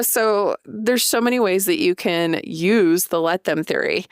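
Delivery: ordinary talk, nothing marked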